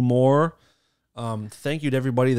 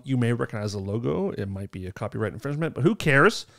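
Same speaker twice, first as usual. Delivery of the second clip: ordinary delivery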